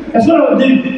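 usual speaking voice

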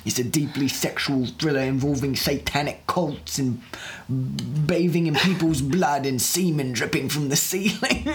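artsy Cockney sales pitch; hammed-up Cockney, laugh breaking in; mock Cockney accent. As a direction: pitch it like a pretentious Cockney auteur. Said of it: "I was also Cockney".

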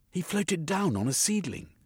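high, earnest explanation; insisting. A narrator's reading. Jeremy insists to the sergeant, high and earnest. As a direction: keep pleading.

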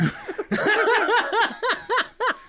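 high loud laughter; burst of laughter. Real, not acted. Recalling putting the studded belt away, laughing out loud. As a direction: crack up laughing. Laughing at his own story.

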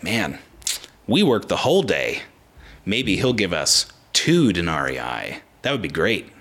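lightly voiced workers, low and unhurried; workers musing. Acting out hopeful workers talking among themselves.

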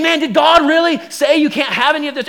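sneaky serpent. Acted. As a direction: bait her with a chummy "really". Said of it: "saying it in a really sneaky way".

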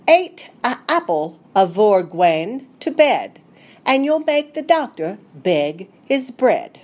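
lightly put-on Devon country accent, reciting; a gentle try at an old Devonian rhyme; rustic Devonian accent, recited with a smile; old Devonian dialect. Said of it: "try my best to present it the way Elizabeth would have".

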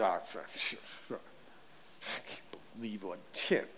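mock grumbling under the breath; grumbling Pharisee. Pharisees grumbling about Jesus, a soft mock mutter. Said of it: "they start grumbling and moaning and complaining".

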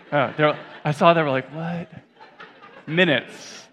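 breaking into real laughter at the blunder; laughing. Real, not acted. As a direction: giggle as you correct yourself. Laughing at his own slip.

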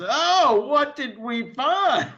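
high, drawn-out mock enthusiasm; fake excitement. Co-host imitating Larry asking what they found, fake excitement. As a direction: gush it loud and high, obviously fake. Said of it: "that sort of fake".

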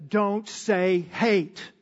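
slow, lightly voiced motherly warning; a hint of a scolding mother, slowed right down; quoting mom. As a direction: mimic mom drawing the words out. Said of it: "Mom always said".

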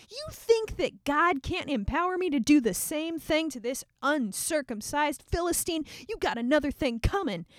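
normal talking voice

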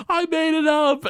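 high-pitched mock wail; Homer's outburst. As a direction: blurt it out as an imitated high cry. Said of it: "And he's like".

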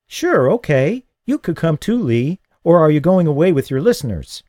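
a hint of a curious kid; lightly voiced boy Paul. A narrator's reading. A boy chatting innocently to the radio host, lightly voiced.

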